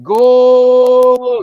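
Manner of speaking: huge, high, stretched goal scream; Mexican announcer goal call. Shown as a demonstration. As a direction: hold the goal call as long as you can. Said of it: "It's my Mexican announcer voice".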